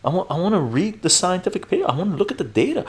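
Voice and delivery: high-pitched, pleading protest; baffled protest. Acted. Streamer playing himself challenged over wanting the data, baffled protest. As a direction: protest innocently in a high, quick voice. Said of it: "I'm going".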